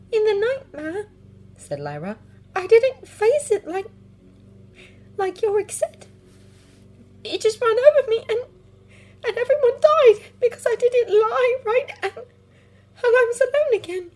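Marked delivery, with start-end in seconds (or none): 0.1-1.0 put-on high, upset young girl. Lyra voiced, upset child. 2.5-14.1 put-on high, upset young girl. Lyra voiced, upset child.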